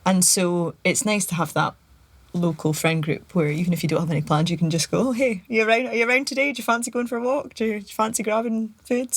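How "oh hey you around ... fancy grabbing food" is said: lightly brighter, casual calling round; breezy invite. Retold or imitated. Friend casually texting a local mate to meet up, upbeat. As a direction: toss off a quick friendly invite.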